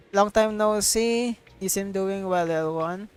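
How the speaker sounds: normal talking voice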